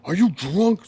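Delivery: high, loud mock yell of a cop; hammed-up shouting cop; retold cop scream. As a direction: yell it like an angry cop in his face. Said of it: "Screaming in my face".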